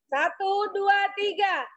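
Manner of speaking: high, drawn-out rallying cheer; cheerleader count-in. Real, not acted. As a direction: count them in, then stretch the cheer long. Group cheer led by instructor.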